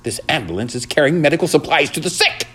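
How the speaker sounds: high, affronted mouse voice; indignant squeak rising through the sentence. A narrator's reading. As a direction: protest with wounded dignity, pitched high. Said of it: "thought Ralph indignantly".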